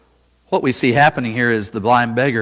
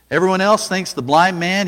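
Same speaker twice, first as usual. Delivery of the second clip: ordinary delivery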